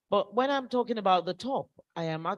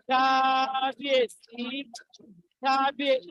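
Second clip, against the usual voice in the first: royal salutation; 5 semitones higher than usual. Real, not acted. Emcee chants royal greeting.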